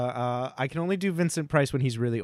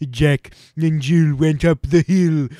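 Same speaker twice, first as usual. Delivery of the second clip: put-on louder, slow elderly storyteller voice; old Vincent Price; 3 semitones higher, 10 dB louder, slower than usual. Old Vincent Price reading a nursery rhyme, slow and loud. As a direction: read it like an old horror host. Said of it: "I can do Vincent Price ... reading the storybook".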